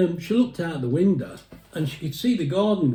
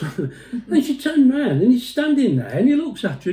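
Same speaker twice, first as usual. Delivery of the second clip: laughing; 8 semitones higher, 4 dB louder than usual. Real, not acted. Laughing at his punchline.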